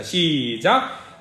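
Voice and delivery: ordinary talk, nothing marked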